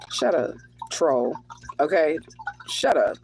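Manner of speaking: laughing it out, dismissive. Real, not acted. Tarot reader to troll in chat, laughing. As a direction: laugh through a brushing-off shut up.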